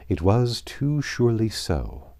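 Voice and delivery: usual speaking voice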